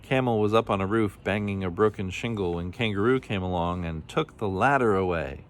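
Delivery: usual speaking voice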